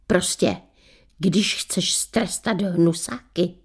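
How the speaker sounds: slow conspiratorial whisper. Acted. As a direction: whisper the final warning. Punchline advice ending story.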